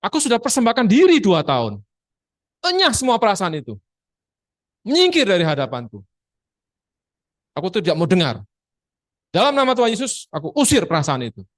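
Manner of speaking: preacher demonstrating a rebuke, commanding. Shown as a demonstration. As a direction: cast it out with authority. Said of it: "Anda harus katakan".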